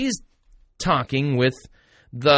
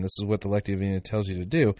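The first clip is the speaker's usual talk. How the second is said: ordinary talk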